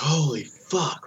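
high, slow, drawn-out swearing; stunned disbelief. Retold or imitated. Dad holding the newborn as Tess faints, stunned. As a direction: stretch both words out high in retold shock. Thinks partner died, holding baby.

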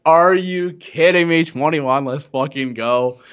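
slow, drawn-out triumphant cheer; hyped celebration. Retold or imitated. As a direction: savour the win, stretching each word. Hits twenty-one, celebrating.

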